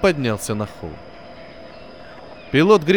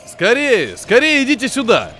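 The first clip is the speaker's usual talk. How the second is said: high, loud call to hurry over; raised-pitch hail, drawing out the first word; excited calling shout; 8 semitones higher, 5 dB louder than usual. A narrator's reading. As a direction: call out urgently across a distance. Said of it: "закричал капитан Гибу".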